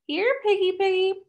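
high sing-song calling, dragging out the last words; taunting call. Acted. Drunk pretties hunting Tally, calling in high sing-song taunt. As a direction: call her like a pet you are hunting.

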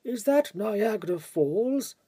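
slow, let-down question, mildly mocking; mock disappointment; disappointed. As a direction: quote his dismay with a light sigh in it. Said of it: "asking disappointedly".

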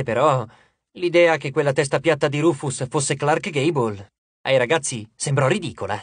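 usual speaking voice

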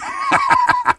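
very high, gleeful villain cackle; short burst of high gloating laughter. Acted. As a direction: cackle with greedy delight. Gloating after a denial.

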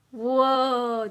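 long, loud awestruck whoa; amazed. Real, not acted. Vlogger admires the new lighting on camera, amazed. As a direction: draw it out loudly in amazement.

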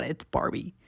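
whispered aside. Real, not acted. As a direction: breathe it out, voiceless. Whispered aside after a review.

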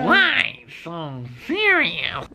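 lightly hammed-up villain line, very slow; mock-menacing catchphrase. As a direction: draw it out slowly like a movie villain. Mimicking a film catchphrase.

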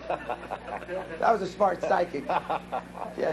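slow, laughing amusement. Real, not acted. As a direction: talk through a chuckle. Laughs at own joke.

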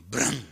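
sudden vocal bang of a door; imitated door slam. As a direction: make a short, punchy slamming noise. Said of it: "Cierra la puerta otra vez".

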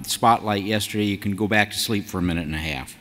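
ordinary talk

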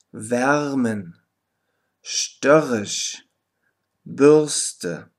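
„War“ mit schwachem, vokalischem R am Ende; deutliche Aussprache. Shown as a demonstration. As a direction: jedes Wort einzeln mit sehr schwachem R vorsprechen. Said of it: "Hör dir wieder die Aussprache gut an". (Lehrerin spricht Beispielwörter mit vokalischem R vor)

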